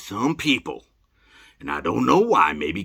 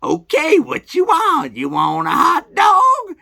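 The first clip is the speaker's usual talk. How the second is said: much higher, louder, slow vendor call; hot dog guy shouting; 7 dB louder, slower than usual. Retold or imitated. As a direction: bellow it like a street vendor hawking. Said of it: "he's like".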